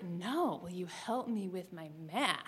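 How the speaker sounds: soft, low, meaningful murmur; pointed hushed repeat. Retold or imitated. Caleb repeating his hint softly across the aisle. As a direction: drop the voice and lean on the hint.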